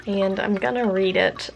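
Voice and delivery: usual speaking voice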